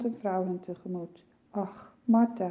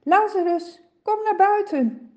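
high, loud call into the tomb; 11 semitones higher, 4 dB louder than usual. Acted. Jesus calls Lazarus out of the grave, loud and high. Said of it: "roept hem".

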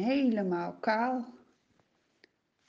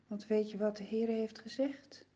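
whispering; 7 dB quieter than usual. Acted. Secret told to listeners.

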